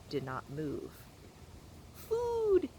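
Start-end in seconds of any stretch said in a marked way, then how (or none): 2.0-2.7 very high squeaky peep; peeping gosling. A narrator's reading. Hungry gosling peeping at Roz, squeaky. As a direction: peep like a tiny chick. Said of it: "peeped the gosling".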